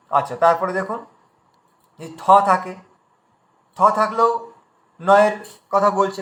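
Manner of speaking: ordinary talk, nothing marked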